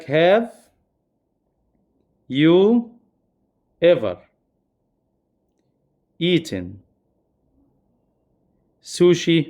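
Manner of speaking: each word of the English question set apart with pauses; spaced-out classroom example; slow example. Shown as a demonstration. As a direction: read the example slowly, word by word. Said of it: "example question spaced out".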